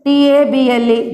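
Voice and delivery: ordinary talk, nothing marked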